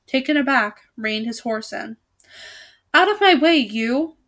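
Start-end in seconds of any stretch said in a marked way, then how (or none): 2.9-4.2 higher, louder roar at a stranger; angry knight's roar. A narrator's reading. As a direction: bellow him out of the way. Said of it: "he roared".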